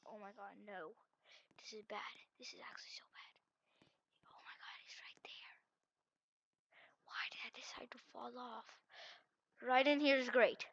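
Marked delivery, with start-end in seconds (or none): 0.1-8.7 barely audible, dismayed whisper; anxious whisper. Real, not acted. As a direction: whisper in dread of being found. Fell, seeker right there.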